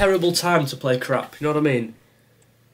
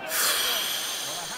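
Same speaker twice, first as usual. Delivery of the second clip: soft, drawn-out exhale; long sigh; 3 semitones higher, 11 dB quieter than usual. Real, not acted. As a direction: sigh quietly in defeat. Dejected after more bad play.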